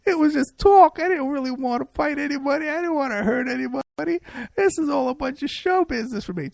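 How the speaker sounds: high whimpering blubber, mocking; exaggerated high-pitched fake sob voice; mock crying. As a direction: whine it like a blubbering coward. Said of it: "he starts crying on the YouTube videos".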